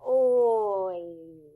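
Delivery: "Oi" stretched, mouth rounding then spreading into a smile; exaggerated oi glide. Shown as a demonstration. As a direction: model "Oi" from O shape into a smile. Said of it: "it's in an O, and then it goes back into a smile".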